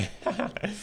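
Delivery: high laugh. Real, not acted. Excited about the gym.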